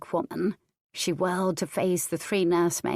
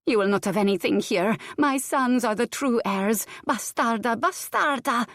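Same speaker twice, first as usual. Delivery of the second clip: raging; 5 semitones higher than usual. A narrator's reading. Countess beating girl, raging.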